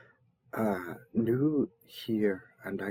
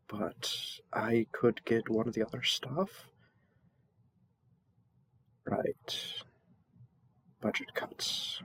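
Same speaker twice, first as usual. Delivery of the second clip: soft whispered aside, a little wry; whispered grumble; 4 dB quieter than usual. Acted. Servant whispering a wry aside about budget cuts. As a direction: grumble it under your breath.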